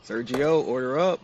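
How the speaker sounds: loud high call across the kitchen. Acted. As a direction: shout the order to the cook. Calling the cook again.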